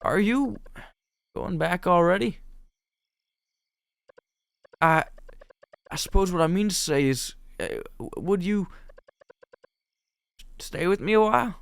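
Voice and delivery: high-pitched, hesitant, halting voice; shy game heroine. Acted. Heroine asking John to stay a while, shyly. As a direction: falter while working up to asking.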